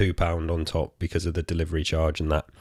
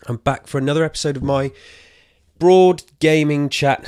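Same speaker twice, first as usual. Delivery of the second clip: panting after running upstairs; breathless, catching air between words; out of breath; 7 semitones higher, 5 dB louder than usual. Real, not acted. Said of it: "Sorry, I'm a little bit out of breath".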